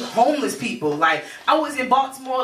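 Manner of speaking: ordinary talk, nothing marked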